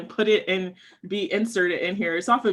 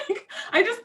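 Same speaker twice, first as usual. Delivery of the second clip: high laughing squeal. Real, not acted.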